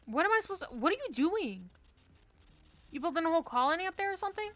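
high, loud and very fast exasperation; exasperated rant. Real, not acted. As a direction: rattle it off, fed up. Annoyed at teammate's play.

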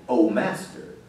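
very low, soft and offhand; casual, unmoved murmur. Shown as a demonstration. Preacher showing how the servant didn't say it, low and offhand. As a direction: say it small, with no cry in it. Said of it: "It wasn't just a".

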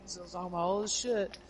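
high, agitated mock rant; imitating political rant. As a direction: rant at the TV like an angry old man. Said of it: "he's like".

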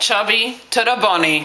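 laughing with crammed mouth; barely talking through marshmallows; cheeks stuffed full. Real, not acted.

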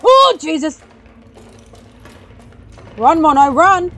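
loud, high burst of panic; startled, urgent yell; alarmed shouting. Real, not acted. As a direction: shout for someone to run. Monster begins chasing.

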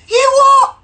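very high, loud put-on voice; incredulous mock squawk. Girl on the train reacting to his news, incredulous. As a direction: screech it in disbelief. Girl's incredulous reply, acted out.

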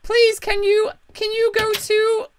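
high, pleading put-on voice; a high disguise voice begging a stranger for help; different voice. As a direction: pitch it way up to hide who you are. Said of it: "we got different voices".

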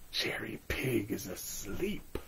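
soft hushed whisper. Real, not acted. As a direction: whisper so the pig stays asleep. Checking sleeping toy, hushed.

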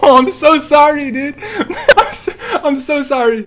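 sorry through laughter; laughing apology. Real, not acted. Gamer apologizing to his teammate, laughing. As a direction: apologize while cracking up.

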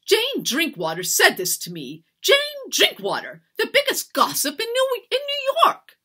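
loud, high-pitched outrage; indignant rant. A narrator's reading. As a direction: rant as a scandalized mistress. Mistress rages about town gossip.

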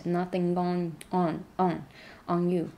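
on stressed with a strong nasal ring. Shown as a demonstration. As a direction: lean on the nasal in on. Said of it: "这个on要很强调，它是个鼻音". (on要强调鼻音)

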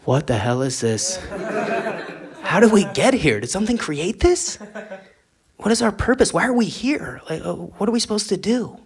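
a hint of a baffled fish pondering its purpose; bewildered fish. Shoe-wearing fish asking why they exist, mildly bewildered. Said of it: "look around and go".

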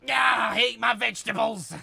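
loud gloating yell; triumphant shout. Real, not acted. Player gloating over a kill, loud shout. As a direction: crow it over the fallen raider.

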